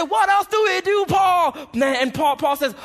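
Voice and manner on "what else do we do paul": eager crowd. Retold or imitated. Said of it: "They'll say".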